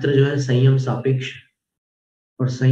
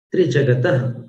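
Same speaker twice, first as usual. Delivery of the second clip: usual speaking voice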